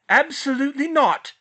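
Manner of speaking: loud, high sharp refusal; snapping. A narrator's reading. Mother snapping at her son's plan to go back. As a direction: snap it shut on him. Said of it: "snapped back".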